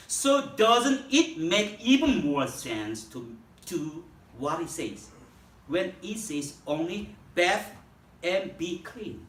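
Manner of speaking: slow, deliberate English Bible reading; scripture reading. Acted. Preacher reads the English verse to the congregation, slow scripture reading. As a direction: read the verse out phrase by phrase, with weighty pauses.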